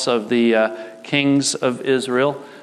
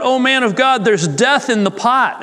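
high-pitched cry of alarm, read aloud; 11 semitones higher, 4 dB louder than usual. A narrator's reading. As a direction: call it out as a narrator voicing alarmed men. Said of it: "they cried out".

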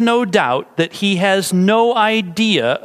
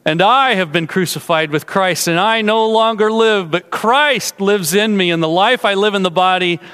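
fervent preaching. Acted. Preacher to congregation contrasting dead Lenin, living Christ, fervently. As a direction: preach it with rising conviction.

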